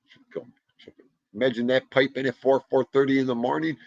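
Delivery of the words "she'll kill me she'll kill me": repeats fading away into silence; fading echo. Shown as a demonstration. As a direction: let each repeat die off like an echo.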